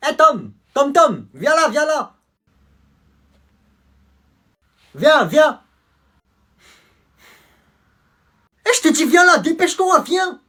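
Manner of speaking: crie très aigu et très fort; appel crié. Acted. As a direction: appelle Tom de loin, pressé. Appelle Tom pour la bagarre. (appel crié vers Tom pour la bagarre)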